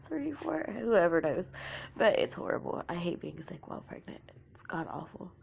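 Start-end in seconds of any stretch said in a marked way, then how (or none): none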